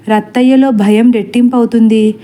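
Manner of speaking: ordinary delivery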